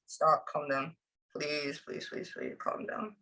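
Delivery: very soft, under-the-breath plea; hushed pleading. Real, not acted. Medium trying to settle what comes through, hushed pleading. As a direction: plead quietly, almost without voice.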